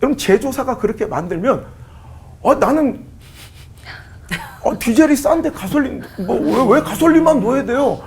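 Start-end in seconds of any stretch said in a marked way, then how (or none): 2.4-8.0 a pretend car whining about its fuel; mock whiny car. Car complains about gasoline. (연료 타박하는 자동차 흉내, 높고 큰 목소리)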